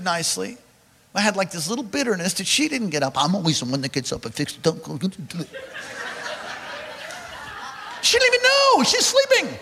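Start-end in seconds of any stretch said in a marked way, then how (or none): 3.2-5.5 grumbling under breath. Retold or imitated. Inner grumbling retold. 8.0-9.6 pitched way up, laughing disbelief; high amused protest. Real, not acted. Pastor to congregation, high amused disbelief. Mocking wife asleep unaware.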